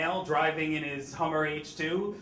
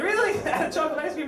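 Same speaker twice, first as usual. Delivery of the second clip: high, louder laughing "Really?"; mock disbelief; 13 semitones higher, 6 dB louder, much faster than usual. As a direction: act amazed with a laugh in it. Dad's amused mock disbelief.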